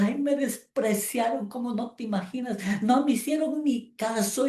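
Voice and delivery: high, hammed-up whine of hurt pride; mock wailing lament. Offended churchgoer wailing about being ignored, mock whine. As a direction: mimic a sulky wail. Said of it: "un lamento y un llanto".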